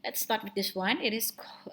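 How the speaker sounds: normal talking voice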